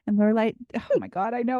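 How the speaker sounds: normal talking voice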